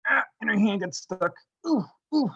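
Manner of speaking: high startled ooh ooh; startled fairy. Acted. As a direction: yelp like a stuck little fairy. Fairy's hand gets stuck.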